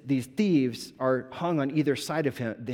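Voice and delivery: normal talking voice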